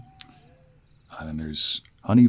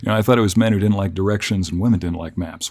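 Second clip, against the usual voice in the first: ordinary talk, nothing marked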